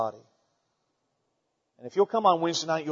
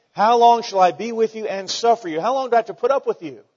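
higher and louder, fed-up rebuke; exasperated outcry; 6 semitones higher, 7 dB louder than usual. Retold or imitated. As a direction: throw up your hands in exasperation. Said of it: "he seems a little exasperated".

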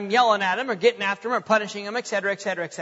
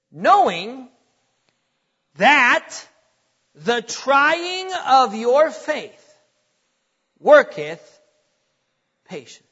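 each phrase set apart by long pauses; weighty, deliberate reading; 6 dB louder, much slower than usual. Shown as a demonstration. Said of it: "get ready, he says this".

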